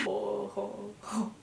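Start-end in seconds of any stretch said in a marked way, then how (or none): none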